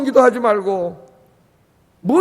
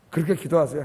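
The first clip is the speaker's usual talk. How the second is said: usual speaking voice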